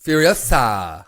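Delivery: Furiosa loud and very drawn out; mock grand pronunciation. Teasing back after being corrected, loud drawn-out Furiosa. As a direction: belt out Furiosa slowly with a long final SAH. Co-host parodies the drawn-out title.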